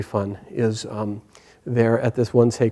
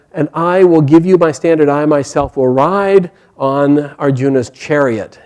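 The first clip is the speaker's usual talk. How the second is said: loud, higher, emphatic vow; proclaimed pledge; 4 semitones higher, 7 dB louder than usual. Acted. Hanuman pledging support to Bhima for the war, proclaiming. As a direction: proclaim the promise grandly.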